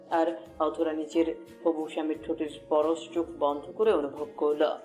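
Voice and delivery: normal talking voice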